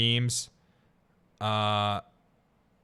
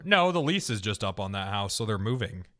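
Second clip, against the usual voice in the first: usual speaking voice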